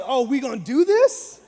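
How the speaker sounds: loud, high mock bravado; mock tough. Husband squaring up to a stranger, mock tough. Teller acts tough back at him.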